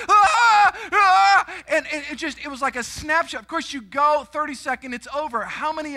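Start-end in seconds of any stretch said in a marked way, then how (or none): 0.1-1.5 two loud, high hammed-up screams; retold screaming. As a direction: scream your head off in mock terror. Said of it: "I'm, like, screaming out of my".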